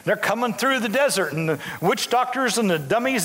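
ordinary talk

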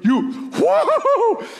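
high delighted whooping; spy whooping at giant grapes, thrilled; excited whoops. Retold or imitated. Spies marvel again.

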